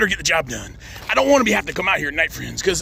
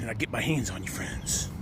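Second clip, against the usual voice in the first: menacing whisper; 9 semitones lower, 11 dB quieter than usual. Acted. Threat ends in a hush.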